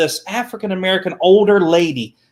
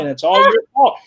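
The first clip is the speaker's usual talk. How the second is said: mock blame; 5 semitones higher, 4 dB louder than usual. Mock blaming refrain, louder.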